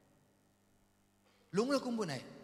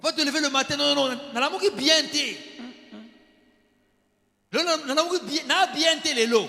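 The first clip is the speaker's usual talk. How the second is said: loud, higher, cranky protest on waking; grumpy morning grumble; 6 semitones higher, 6 dB louder than usual. Acted. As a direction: grumble it like someone who got up on the wrong foot. Grumpy spouse voiced on waking.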